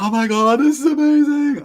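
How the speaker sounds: high, loud mock squeal of awe; imitated gushing. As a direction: gush like an awestruck tourist, voice climbing. Tourists gushing at the view.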